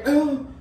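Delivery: sudden high gasp; sharp gasp. Real, not acted. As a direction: gasp at the burn. Lens burns.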